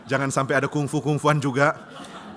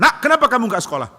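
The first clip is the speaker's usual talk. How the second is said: raised, high-pitched scolding; father shouting at his idle son; 9 semitones higher, 9 dB louder than usual. Acted. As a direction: call the boy out loudly.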